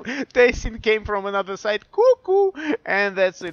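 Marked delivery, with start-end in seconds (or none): 1.9-2.5 shrieked cuckoo, very high; loud high cuckoo call. Real, not acted. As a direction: scream cuckoo out. Caster's catchphrase.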